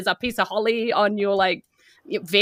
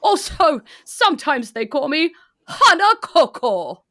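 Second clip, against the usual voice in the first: put-on higher, louder, chuckling old man; jolly Santa; 7 semitones higher, 5 dB louder than usual. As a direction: chuckle through it, pleased. Santa naming himself.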